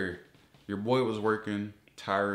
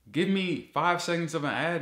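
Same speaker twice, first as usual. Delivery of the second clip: normal talking voice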